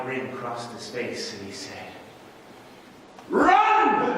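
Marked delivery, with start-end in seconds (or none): 3.3-4.1 high, loud yell; explosive cry to flee; shouted command. Retold or imitated. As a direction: burst out with a sudden full shout.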